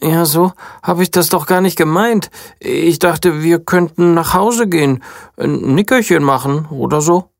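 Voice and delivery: lightly voiced weary princess; whiny princess. A narrator's reading. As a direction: moan about sore feet like a spoiled princess. Princess disappointed.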